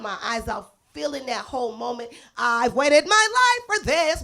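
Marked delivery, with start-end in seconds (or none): 2.4-4.2 emotional outburst. Retold or imitated. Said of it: "was my inner monologue".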